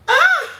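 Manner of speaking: loud, high-pitched "ah"; high squeal. Real, not acted. Streamer joking with viewers, high squeal. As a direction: let out a short, high squeal.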